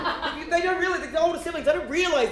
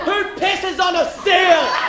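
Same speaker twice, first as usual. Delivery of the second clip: slow, higher, louder disbelief; incredulous; 6 semitones higher, 5 dB louder, much slower than usual. Real, not acted. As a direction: drag it out in disbelief. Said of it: "incredulous punchline".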